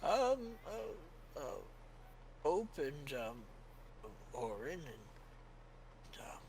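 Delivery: put-on high, frail old cleric fading to a whisper; a tired old father losing his train of thought; weary old priest. Old priest greeting returning adventurers, trailing into a whisper.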